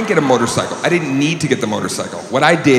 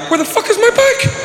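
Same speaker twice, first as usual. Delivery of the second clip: mock outrage shout. Man faking a stolen bike to a date, high hammed-up shout. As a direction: wail like your bike was stolen.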